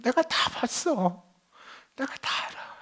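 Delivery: slow, hushed words fading to a whisper; God's tender voice. Acted. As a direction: speak softly as a loving God who saw it all.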